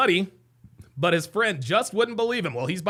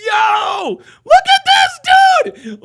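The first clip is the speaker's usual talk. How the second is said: much higher and louder, shrieking with laughter; laughing shout; 10 dB louder than usual. Real, not acted. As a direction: yell it out, cracking up at the clip. Bursting out laughing at clip.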